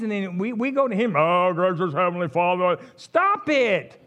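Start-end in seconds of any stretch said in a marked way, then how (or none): 1.2-2.8 mock-pious, stiff church praying voice; put-on churchy prayer. Preacher mocking how people talk to God, pompous prayer voice. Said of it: "we talk like this". 3.0-4.0 high, loud "cut it out"; exasperated scolding. Real, not acted. Preacher telling congregation to quit churchy praying, exasperated scolding. Scolding after mock prayer.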